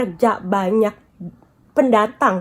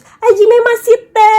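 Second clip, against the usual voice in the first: slow, much higher, louder Hajime Masite; bow-like formal greeting, drawn out; mock textbook Japanese introduction; polite Japanese greeting; 9 semitones higher, 8 dB louder, much slower than usual.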